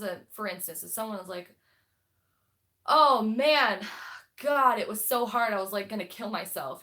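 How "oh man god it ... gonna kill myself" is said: loud, overblown moan about a hard day; mock dramatic complaint. Friend joking about a hard day, hammed-up loud moan. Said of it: "you know, joking".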